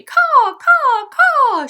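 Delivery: loud high screeching caws; crow caws. Acted. Crow singing out for the fox, loud screechy cawing. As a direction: squawk like a proud crow. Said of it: "she screamed".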